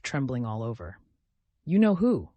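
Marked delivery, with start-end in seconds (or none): 1.6-2.3 breaks out higher and louder, helpless; helpless outburst. A narrator's reading. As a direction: let it escape him. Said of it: "Broke helplessly from him".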